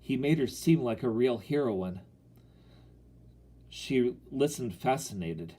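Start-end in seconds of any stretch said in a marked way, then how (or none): none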